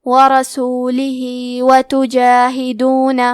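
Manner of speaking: the ہِ in وَرَسُولِهِ stretched for two counts; two-count lengthening. Shown as a demonstration. Teacher shows students the short lengthening, slowly. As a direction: hold the final vowel of وَرَسُولِهِ a little longer. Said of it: "اپنی آواز دو مد کے برابر لمبی کریں گے".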